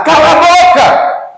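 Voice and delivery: high, loud, slow screamed order; furious shout. Retold or imitated. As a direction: scream at him to shut up. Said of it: "a juíza grita de lá".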